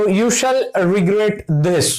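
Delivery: very slow, word by word, like dictating; slow dictation. Shown as a demonstration. Teacher reading an example sentence to the class, very slow. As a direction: spell the example out slowly for students. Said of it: "तो sentence देखो".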